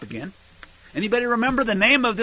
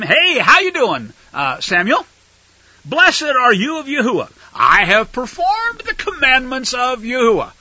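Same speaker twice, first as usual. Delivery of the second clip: smug king; 6 semitones higher, 4 dB louder than usual. Acted. Saul greets Samuel, acted.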